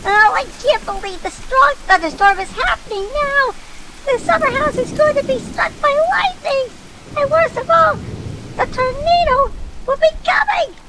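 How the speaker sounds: very high, loud shrieking panic; high-pitched hysterical storm rant; panicked Valerie. Acted. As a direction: wail in a squeaky full-volume voice. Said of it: "still in a panic mode".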